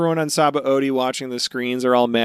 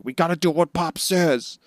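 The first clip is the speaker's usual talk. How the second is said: raised-pitch imitation of urgent crewmates; urging retelling; 6 semitones higher, 3 dB louder than usual. Crewmates grab the hesitant ones to make them leave, urgent imitation. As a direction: urge him along as a retold quote. Retold crew urging a comrade.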